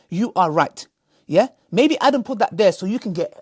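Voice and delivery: normal talking voice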